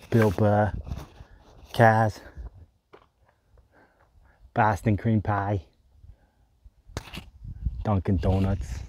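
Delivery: a clumsy, hammed-up Boston accent; slow, stretched-out try at a Boston accent on cream pie; bad Boston accent. Shown as a demonstration. Hiker lists Boston things in a bad Boston accent. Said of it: "Terrible accent".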